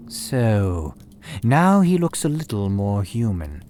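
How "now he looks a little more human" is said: ordinary delivery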